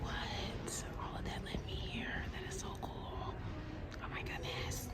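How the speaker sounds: real hushed whisper in a gallery; soft museum whisper. Real, not acted. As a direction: whisper it, moved and amazed.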